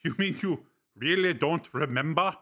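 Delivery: Breck, teasing friend. Acted. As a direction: needle him with dry amusement. Breck amused.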